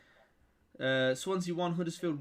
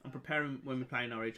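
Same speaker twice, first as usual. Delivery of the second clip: lightly put-on posh English, a bit lower and softer; a hint of a plummy toff, said to the chat; 4 semitones lower, 5 dB quieter than usual. Shown as a demonstration. Fan jokingly goes posh before the Norwich game, lightly plummy. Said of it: "I'm getting a little bit posher".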